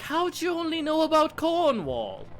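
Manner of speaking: put-on English accent. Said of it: "they were like".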